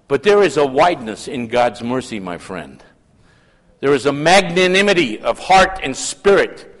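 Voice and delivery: usual speaking voice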